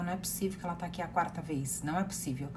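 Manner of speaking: quiet, quick muttered disbelief under the breath; a mild hushed aside of disbelief. Retold or imitated. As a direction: say it low to yourself, stunned. Inner disbelief at the ritual.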